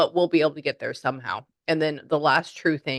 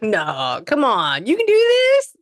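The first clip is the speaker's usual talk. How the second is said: high, loud rallying cry that climbs at the end; excited cheering on; 7 semitones higher, 7 dB louder, slower than usual. Retold or imitated. Excited spirit urging the medium on, excited cheering. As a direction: cheer them on, pitch soaring up. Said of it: "somebody is really excited".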